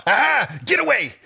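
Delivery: panicked. Retold or imitated. Quoting Buck's panic.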